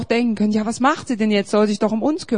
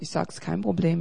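ordinary talk, nothing marked

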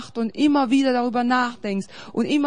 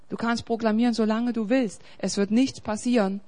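ordinary talk

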